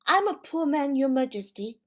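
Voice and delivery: high, loud pleading tremble; trembling voice. A narrator's reading. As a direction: beg the king, shaking with fear. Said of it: "in a trembling voice".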